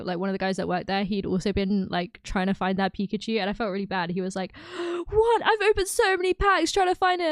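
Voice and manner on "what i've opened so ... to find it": higher-pitched, incredulous retelling; playful mock outrage; mock dismay. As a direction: imitate someone aghast at missing out. Acting out dismayed card store worker.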